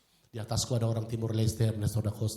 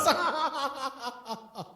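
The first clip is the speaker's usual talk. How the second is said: high laugh; 13 semitones higher than usual. Real, not acted. Laughing at own joke.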